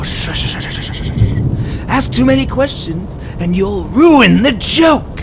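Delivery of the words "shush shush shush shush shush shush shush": shushing. Acted. Joker hushing waking captive.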